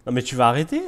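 loud indignant plea; loud exasperated protest. Real, not acted. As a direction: yell at him to stop bidding. Rival keeps bidding.

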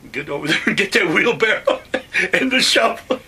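loud order broken by laughter; laughing order. Retold or imitated. Officer sending him to the wheelbarrow, loud and laughing. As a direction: bark the order and crack up.

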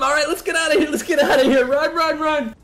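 high, loud, laughing escape; fleeing in giddy panic; being chased. Real, not acted. Escaping after kill.

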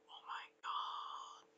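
slow, very soft whisper; whispered shock. Retold or imitated. Sci-Fi sees the army coming, slow stunned whisper. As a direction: breathe it out in a retold gasp of disbelief.

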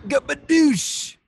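high, slow goofy shout; silly exclamation. Real, not acted. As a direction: stretch out a goofy cheer.

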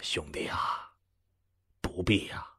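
quiet, unruffled reassurance; hushed and steady, no fuss; calm and composed. Acted. Mr Leng calmly soothing his upset friend, quiet. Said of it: "冷先生却依然不动声色".

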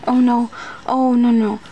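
loud sudden dismay at a mistake; alarmed oh no. Real, not acted. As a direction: blurt it out as if you just spotted a mistake. Realises she put it in the wrong place.

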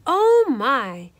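loud, lightly moaned exclamation; mock moan. A narrator's reading. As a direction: groan it out with some force. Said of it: "Leola moaned".

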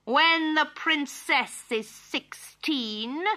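very high, drawn-out shrieking curse; wicked fairy's curse. A narrator's reading. Thirteenth fairy curses the baby princess, high slow screech. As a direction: screech it slowly, building to the last word.

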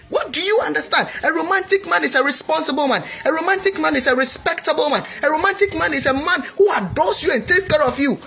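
high, forceful preaching voice; emphatic preaching. Real, not acted. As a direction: drive each point home like a preacher. Emphatic teaching on romance.

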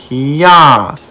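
drawn-out "hee-yah" on the attack; karate cry. Pretend. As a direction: stretch out a playful karate cry. Karate cry breaking the window.

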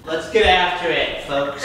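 high, slow hype host; rally the folks like a host; announcer voice. Acted. Announcing to the audience.

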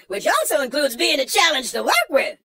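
ordinary delivery

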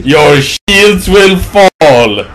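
very slow, drawn-out hammy threat; overacted, booming game-villain imitation; mock cheesy villain. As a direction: mimic the villain's line, stretching every word. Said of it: "That's so canned and cheesy!".

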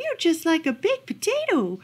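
high, delighted exclamation; exclaiming. A narrator's reading. Lady naming what her dog resembles, exclaiming. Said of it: "she exclaimed".

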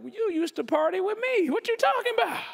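a high mocking voice, rising up sharply; a lightly voiced skeptical college friend; old party friend. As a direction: tease him like an old partying buddy. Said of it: "and they're like".

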